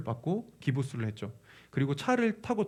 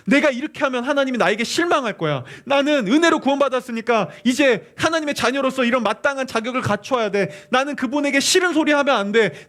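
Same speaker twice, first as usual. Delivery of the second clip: loud, high, driven inner monologue; anxious self-reproach; 10 semitones higher, 8 dB louder than usual. Acted. Believer telling himself he must qualify as God's child, anxious. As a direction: press each self-imposed rule harder, voice climbing.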